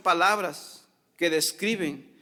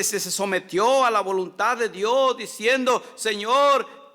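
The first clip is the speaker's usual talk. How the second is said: loud, higher impassioned sermon; fiery preaching; 7 semitones higher, 6 dB louder than usual. Acted. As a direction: preach it out to the whole congregation. Preaching at full force. (predicación fervorosa, fuerte y alta)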